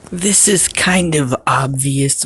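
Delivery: ordinary delivery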